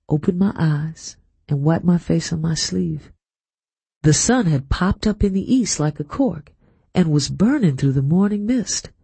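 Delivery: plain reading voice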